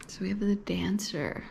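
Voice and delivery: hushed whispered reveal. Real, not acted. Reader revealing the first card to viewers, whispered. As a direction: whisper it as the card turns over.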